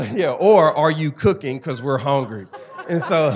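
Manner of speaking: the students' hungry request, laughed out; loud laughing retelling of the hungry students. Real, not acted.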